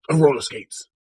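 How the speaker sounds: usual speaking voice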